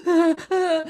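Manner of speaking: high gasping mock sobs, trying to speak; imitated sobbing gasps. Imitating herself as a crying kid, high gasping sobs. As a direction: gulp out broken sounds like a crying child. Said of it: "trying to express through tears".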